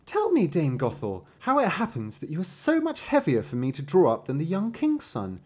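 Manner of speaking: high-pitched innocent storybook heroine; N-voiced Rapunzel. A narrator's reading. Rapunzel asking Dame Gothel about her weight, high character voice. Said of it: "Rapunzel said to her".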